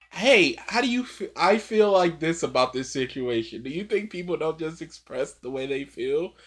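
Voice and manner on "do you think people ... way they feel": ordinary talk, nothing marked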